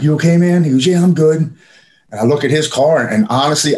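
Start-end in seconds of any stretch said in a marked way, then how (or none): 0.0-0.6 pitched high, a bit louder, urgent; anxious check-in. Retold or imitated. Drunk driver checks on the man he rear-ended, high and urgent. 0.9-1.4 pitched high, a bit louder, urgent; anxious check-in. Retold or imitated. Drunk driver checks on the man he rear-ended, high and urgent. Other driver answers, quoted.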